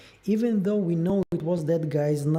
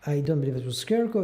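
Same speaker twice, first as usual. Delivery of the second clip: crackling mic noise; crackly usb mic; 2 semitones higher than usual. Real, not acted. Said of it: "it's not the food crackling, it's your mic".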